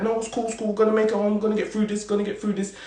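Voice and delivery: acted-out murmur of coping; quiet self-reassurance. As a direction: softly talk yourself through it. Quotes himself nervously reassuring himself.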